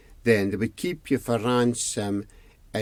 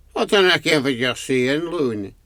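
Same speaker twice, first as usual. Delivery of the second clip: put-on old man voice; 3 semitones higher than usual. A narrator's reading. Old man roused confused.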